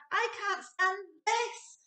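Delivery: high, slow, complaining; fed-up Mister Bear. A narrator's reading. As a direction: drag it out like a sleepless father. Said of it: "said Mister Bear".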